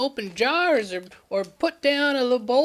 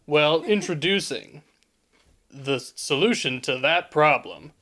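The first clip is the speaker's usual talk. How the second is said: low, slow commercial announcer; deep announcer voice; 9 semitones lower than usual. Acted. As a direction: boom out the product reveal. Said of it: "in a normal T V commercial".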